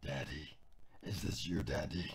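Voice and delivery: very low, slow, menacing rasp; deep kid villain voice. Pretend. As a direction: scare whoever calls with a deep growl. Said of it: "my first voice I could ever do".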